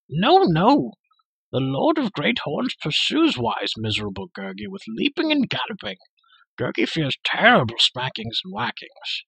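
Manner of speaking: the creature Gurgi grumbling for food; whiny creature. A narrator's reading. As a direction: complain like a sulky beast.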